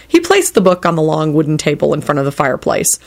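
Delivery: usual speaking voice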